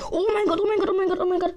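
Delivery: high, loud and frantic; panicked exclamation. Real, not acted. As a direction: blurt it out in real panic. Panics in a tight fight.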